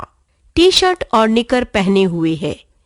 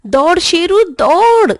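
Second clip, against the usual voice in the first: high, loud urging shout; urging the dog; 7 semitones higher, 5 dB louder than usual. A narrator's reading. Children shout together urging Sheru the dog, loud. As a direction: spur the dog on. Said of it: "सब बच्चे एक साथ".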